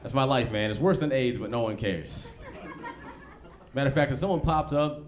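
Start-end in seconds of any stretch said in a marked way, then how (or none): none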